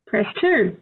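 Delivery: ordinary delivery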